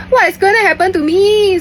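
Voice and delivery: high, loud panicked cry. Retold or imitated. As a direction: squeal it in mock despair. Panicked inner thought, very high loud.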